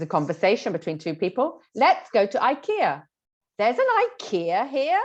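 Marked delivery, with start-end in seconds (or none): 1.7-3.0 higher, louder upbeat suggestion; lightly acted, cheery proposal to go out; example dialogue line. Shown as a demonstration. Acts example conversation. 3.6-5.0 higher-pitched, disbelieving, rising on "ikea here"; emphasize "ikea" with a surprised rising lilt; like you never expected it; acted surprise. Shown as a demonstration. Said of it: "emphasizing that word ikea, it kind of gives the idea that Laura did not expect".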